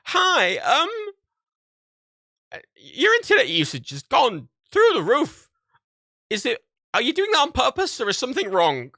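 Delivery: imitating the ISP guy. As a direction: sound like a worried support agent on the phone. Phone call from internet provider.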